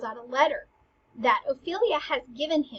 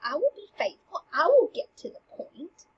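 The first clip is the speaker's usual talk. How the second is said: loud, higher and sassy, brushing the queen off; sassy Polonius; 4 semitones higher, 7 dB louder than usual. Acted. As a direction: talk back to the queen with cheek. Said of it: "Polonius gets really sassy".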